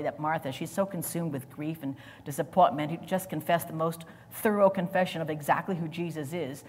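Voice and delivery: ordinary talk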